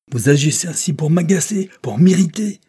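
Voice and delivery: furious judge roaring at his daughter; thunderous shout. A narrator's reading. Judge thundering at his stubborn daughter, furious shout. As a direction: bellow it like thunder. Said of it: "cria le juge d'une voix de tonnerre".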